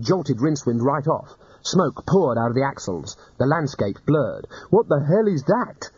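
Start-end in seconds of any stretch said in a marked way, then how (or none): 4.7-5.9 frightened wizard shouting over the rattle; alarmed yell. Acted. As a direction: yell it in a panic. Rincewind alarmed again.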